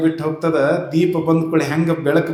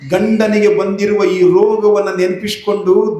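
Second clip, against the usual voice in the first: high, ringing sermon proclamation; preacher heralds the holy mother, high and ringing; preaching at full force; 6 semitones higher than usual. Acted. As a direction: proclaim her arrival to the crowd. Preacher describes wife's grief.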